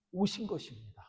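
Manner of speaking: ordinary delivery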